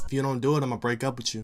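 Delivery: quietly threatening to dump him; mock girl's threat. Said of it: "She goes".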